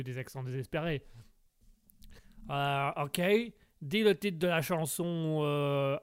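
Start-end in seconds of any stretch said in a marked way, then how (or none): none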